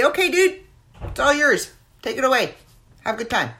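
high, upbeat, bouncy handoff to a buddy; breezy handoff. Pretend. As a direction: toss the mic to a friend, all cheer. Handing show to guest host.

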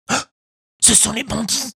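put-on high, startled puppet boy; sudden fright at the bandits; startled gasp. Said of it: "se dit-il".

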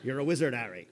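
put-on second try at a West Country accent; a sheepish Hagrid West Country accent. Hagrid telling Harry the news, a West Country accent. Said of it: "I feel a little sheepish trying to do Hagrid's accent".

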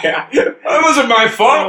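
high, slow mock protest; mock defensive. Real, not acted. Comedian protesting his innocence to the laughing host, mock-defensive. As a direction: plead innocence with a grin.

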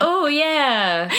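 high mocking "oh yeah"; squeaky mock agreement. Mock voice quoting Stephanie.

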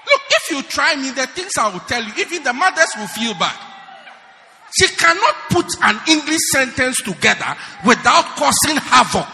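loud, rousing preacher's shout; fiery preaching. Acted. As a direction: hammer it home from the pulpit. Preaching loudly at congregation.

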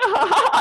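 imitated high, loud laugh; elated laughter. Boy laughing through tears, elated mock laugh. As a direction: laugh wildly like an elated kid. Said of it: "like, elated. He was like".

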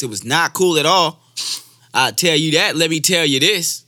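high, loud emphatic repeat. Shown as a demonstration. Imitating Doctor Umar's repeated lines, loud high-energy third take. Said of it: "have more energy the third time".

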